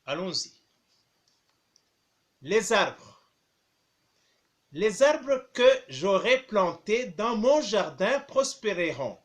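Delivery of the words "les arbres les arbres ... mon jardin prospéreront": dictating. Shown as a demonstration. Teacher dictating a text.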